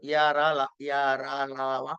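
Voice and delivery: touch lightly on "యా రా లా వా"; semivowel demo. Shown as a demonstration. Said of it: "తగిలి తగలకుండా". ("యా రా లా వా" తగిలి తగలకుండా)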